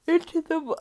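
mid-yawn, pitch jumps up; words swallowed by a yawn. Real, not acted. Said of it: "I just yawned again".

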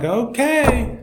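high, comic suit-yourself okay; mock give-up. Helicopter crew leaving the man on his roof, mock reluctant.

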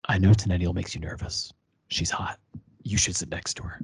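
lightly growled lines, low and hushed; husky Han voice. Retold or imitated. Podcaster imitating Han urging Luke toward Leia, low husky voice. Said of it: "Or, sorry, huskily".

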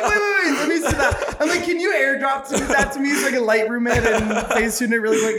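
high, eager, laughing rush; excited squeal. Retold or imitated. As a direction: beg to see the photo, giddy.

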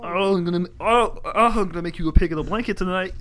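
high, quick put-on cartoon voice; silly cartoon voice. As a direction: play a goofy cartoon character. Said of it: "always change our voices".

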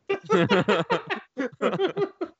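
loud high cackle; high laugh. Real, not acted. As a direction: burst out laughing. Laughing at own joke.

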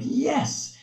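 high-pitched, eager exclamation; imitated coach's excitement. Coach to a kid who just made free pan, excited imitation. Partner cheering the kid's success.

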